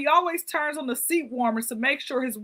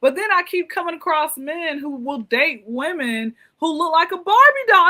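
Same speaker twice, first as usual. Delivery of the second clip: ordinary talk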